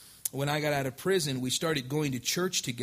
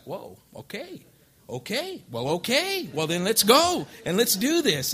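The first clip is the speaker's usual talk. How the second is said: imitating a stunned whoa okay, rising much higher; retold disbelief building to louder excitement; surprised then eager; 6 semitones higher, 6 dB louder than usual. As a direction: caught off guard, then pumped up and louder. Stunned she agrees, then eager.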